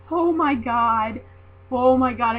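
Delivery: loud, drawn-out fangirl gasping of words; re-enacted starstruck outburst; starstruck gushing. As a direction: gush it slowly and loudly, overwhelmed. Re-enacts being waved at by wrestler.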